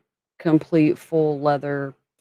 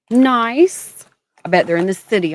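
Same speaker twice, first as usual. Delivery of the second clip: excited; 6 semitones higher, 8 dB louder than usual. Real, not acted. Excited reply.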